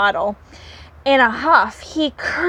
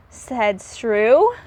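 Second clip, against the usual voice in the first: usual speaking voice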